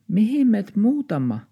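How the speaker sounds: high small-dog voice; little dog asking. Acted. Little dog asks its owner where they're moving, high. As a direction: ask anxiously like a tiny dog. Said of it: "taksi kysyi".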